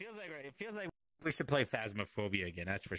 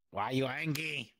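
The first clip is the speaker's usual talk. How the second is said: normal talking voice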